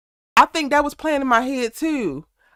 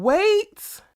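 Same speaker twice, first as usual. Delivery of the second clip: louder, high-pitched mock scream; retold scream; 10 semitones higher, 5 dB louder than usual. Fan retelling Kane screaming like a little boy, mock scream. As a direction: imitate a helpless cry, pitch shooting up.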